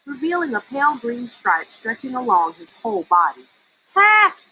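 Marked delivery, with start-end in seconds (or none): 3.9-4.4 sharp startled cry, much higher and louder; shocked gasp. A narrator's reading. Verdi sees his skin turn green, startled cry. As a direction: yelp in shock at the green stripe. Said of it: "he gasped".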